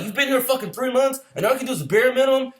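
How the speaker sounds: high-pitched incredulous scolding; mock berating. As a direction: chew out a recruit in disbelief, imitated. Retold drill instructor berating a recruit.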